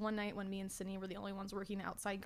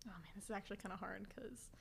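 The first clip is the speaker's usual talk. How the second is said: whispering; 10 dB quieter, faster than usual. Real, not acted. Whispered aside while ranking jobs.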